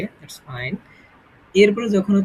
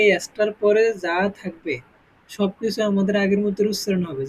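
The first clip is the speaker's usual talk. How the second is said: ordinary talk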